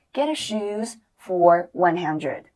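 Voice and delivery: slow, clear drill phrase; slow model phrase. Shown as a demonstration. Teacher models the full phrase for students, slow. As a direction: say it slowly for students. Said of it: "花了多少钱呢".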